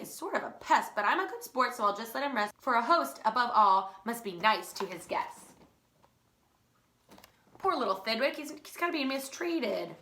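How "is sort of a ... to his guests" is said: ordinary delivery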